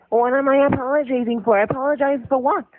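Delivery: high, whiny mocking voice; mocking imitation. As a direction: sneer her words back in a high whine. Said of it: "You said".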